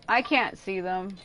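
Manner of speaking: ordinary delivery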